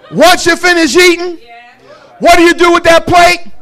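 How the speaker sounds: loud, high preaching. Acted. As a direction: drive the point home preaching. Preaching at full force.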